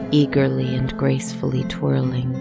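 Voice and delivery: usual speaking voice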